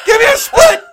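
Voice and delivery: very high, loud pleading shout; excited yell. Retold or imitated. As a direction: yell the plea at full pitch. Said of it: "He's like".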